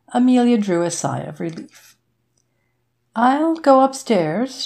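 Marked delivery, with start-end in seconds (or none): 3.1-4.6 slow, drawn-out and a touch higher; said slowly. A narrator's reading. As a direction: hold back each word, slowly. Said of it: "she said slowly".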